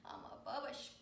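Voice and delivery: breathy whispered pet line; whispered dog voice. Pretend. Dog talking about himself, whispered.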